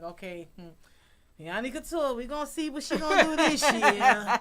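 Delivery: loud, high teasing imitation of the staff; mock the crew, laughing; imitating the crew. Backstage crew teasing the designer about being late, loud and laughing. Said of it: "they know me so good that they know, like".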